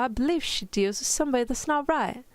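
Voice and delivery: mock-British protest; attempted British accent. Shown as a demonstration. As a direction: read in a badly faked British voice. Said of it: "in your best British accent".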